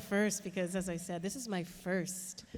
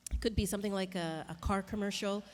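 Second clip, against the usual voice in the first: ordinary talk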